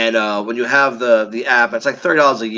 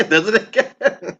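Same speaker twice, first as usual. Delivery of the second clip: higher, slow words through laughter; laughing; 7 semitones higher, slower than usual. Real, not acted. Cohost cracking up at the woman's repeat arrests, laughing.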